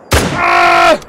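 high, loud wordless yell; frustrated scream. Real, not acted. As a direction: scream out the miss. Misses the next shot.

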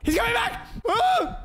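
high, frightened shout as the monster returns; panicked cry. Real, not acted. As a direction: yelp in real panic. Monster chasing in horror game.

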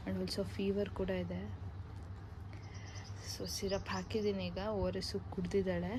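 soft, subdued voice; hushed update. Real, not acted. Mother updating viewers on her resting sick child, hushed.

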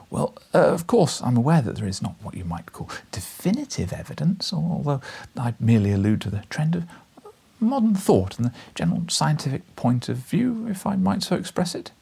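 put-on nervous young journalist. A narrator's reading.

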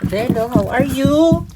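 high and loud hello to the cat; loud pet greeting. Real, not acted.

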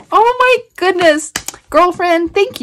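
high and loud, bursting with joy; overjoyed squeal. Real, not acted. Birthday twin reads the note, loud and thrilled. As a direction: gush at her friend.

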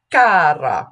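cara with an open, forward ah; Italian bright ah. Shown as a demonstration. Coach modelling Italian vowels for singers. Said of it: "When we sing caro mio ben".

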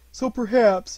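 a touch of sadness in a character voice; sad, to himself. A narrator's reading. Bear fears he won't be told things, mildly sad. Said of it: "he said sadly to himself".

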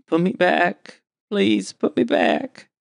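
high, slow, broken plea; pleading mumble. Acted. The wounded captain begs from the ground, high and slow. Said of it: "you can hear him saying".

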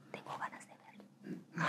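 breathy whisper to herself; hushed inner voice; whispered desire. Retold or imitated. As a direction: whisper it like a secret craving.

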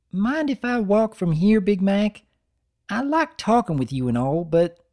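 a filly asking politely to walk; shy filly. Acted.

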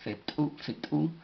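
repeated teacher's demo of Fetu; explosive T. Shown as a demonstration. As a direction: pop the T into the u of Fetu. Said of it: "T explosiva U".

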